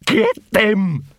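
high-pitched furious attack order; furious yapping. A narrator's reading. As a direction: yap it out like an enraged poodle. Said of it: "Big Fido's furious yapping".

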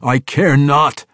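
loud, slow firm yell. Acted. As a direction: bark it down at him. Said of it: "Drizzt yelled at him".

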